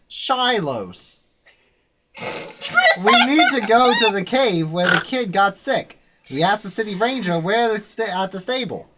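put-on old-time serial announcer recap; Legend of Korra announcer. Game master recaps the adventure to players, announcer voice. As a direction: punch out each phrase like an episode recap. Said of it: "I'll be the announcer from The Legend of Korra".

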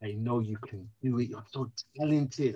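a hint of the wife's tender belief in him; tender encouragement. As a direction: soothe him like a loving wife. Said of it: "she would say".

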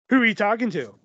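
mock accusing question; confrontational questioning. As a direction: demand it as a suspicious partner, mildly exaggerated. Acted quarrel with suspicious partner.

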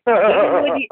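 loud, high ringside holler; mock yell at the wrestler; uncle yelling. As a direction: bellow it like a fan. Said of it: "He says".

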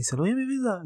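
high-pitched, indignant retort; indignant retold reproach. Waiting date confronting the no-show about not warning, indignant. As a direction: throw the question back, pitched well up.

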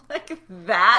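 laughing loudly in disbelief. Real, not acted. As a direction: laugh it out, incredulous.

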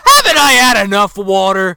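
loud, high, hammed-up indignation; mock exasperated yell. Soaked man refuses cup of water, mock exasperated yell. Soaked Ollie snaps at Stanny.

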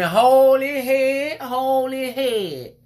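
high, loud, slow screeching of the order; mock scream. Imitating a lady screaming at him down the aisle. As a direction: shriek it across the aisle like a nosy stranger. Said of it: "She screaming".

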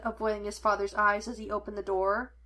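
ordinary delivery, nothing marked

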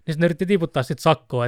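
ordinary talk, nothing marked

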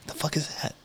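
hushed, whispered bewilderment; baffled whisper. Retold or imitated. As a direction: whisper it as a confused aside. Retold baffled reply to unknown dish.

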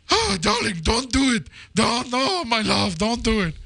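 plead, lovelorn, in a high falsetto; Anthony Quinn impression. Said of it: "that's my Anthony Quinn impression".

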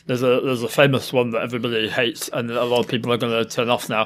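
put-on giant frog voice; frog character voice. Shown as a demonstration. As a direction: slip into an odd frog character. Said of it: "specific examples of characters that I've done".

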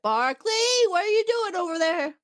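high-pitched mock call across a room. Bridge crew calls over to Barclay.

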